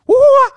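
high, loud comic wail; flying yelp. Retold or imitated. Comic mimicking getting kicked into a cartwheel, yelping. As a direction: yelp like you're hurled through the air.

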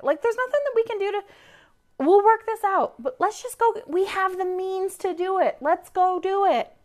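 high, pushy pep talk; urging partner on. Retold or imitated. In the car to her riled-up husband, high urging. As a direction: push him to just get going. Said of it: "I was like".

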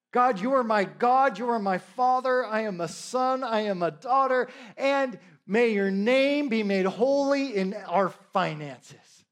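slow, fervent spoken prayer; earnest prayer. Acted. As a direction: declare the prayer slowly and fervently. Leads the congregation in prayer.

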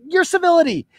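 ordinary talk, nothing marked

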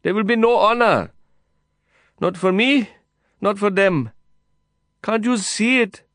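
high, loud begging. A narrator's reading. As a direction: beg him over the noise. Heated exchange through prison wire.

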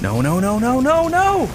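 from urgent to high, loud despair; escalating cries of no; rising panic. Acted. Garage door closing on him.

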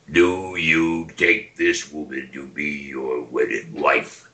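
low, slow solemn minister; gourd-head minister voice. Shown as a demonstration. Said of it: "I did the voice of the gourd-head minister".

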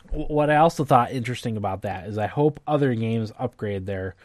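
normal talking voice

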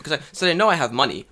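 ordinary delivery

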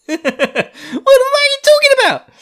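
very high, loud mock outrage; hammed-up yell at full pitch; incredulous shriek. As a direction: screech it in comic exasperation. Repeats the outburst, escalated, after laughing.

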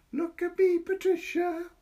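put-on high, loud child's call; crying out. A narrator's reading. Boy dancing round Patricia, calling out high and loud. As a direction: shout it up high like a gleeful boy.

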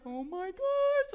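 slow, high, drawn-out oh my gosh; excited gasp. Retold or imitated. Seeing the flower box from him, a slow thrilled gush. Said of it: "And I'm like".

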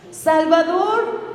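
normal talking voice

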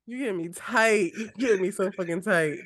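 ordinary talk

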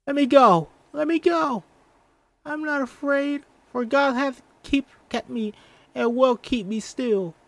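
high, loud frightened struggling; little girl pinned and scared; panicked child. Acted. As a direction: cry to be let go. Mercy struggles, grabbed.

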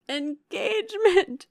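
high, loud, drawn-out exclamation; shocked cry. A narrator's reading. Younger sister startled at talk of her engagement, shocked cry. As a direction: cry it out in protest. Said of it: "cried Marianne".

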